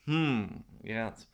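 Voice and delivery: usual speaking voice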